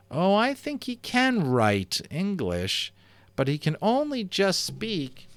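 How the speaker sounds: high, slow careless reply; boy carelessly hinting at the secret, slow and high; unwary boy. Acted. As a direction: let it slip slowly, too high. Omri blurts out the secret.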